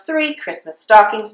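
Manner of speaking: usual speaking voice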